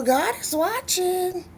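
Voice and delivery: mama's voice, very high and drawn out; mother's reminder. Retold or imitated. Daughter hearing mama when out with friends, high warning. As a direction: imitate a mother's slow, high-pitched reminder. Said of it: "I will always hear her say".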